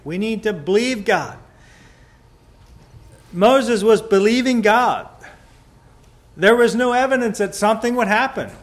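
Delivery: loud, high, fast pulpit proclamation; fervent sermon climax; preaching. Acted. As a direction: drive it home to the congregation. Preacher proclaims Moses' faith.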